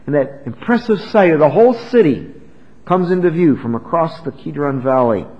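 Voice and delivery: normal talking voice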